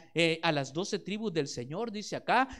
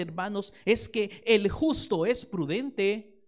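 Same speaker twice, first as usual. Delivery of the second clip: usual speaking voice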